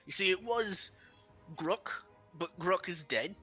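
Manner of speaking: high, pompous giant head; a dim giant trying to sound wise; the other head. Acted.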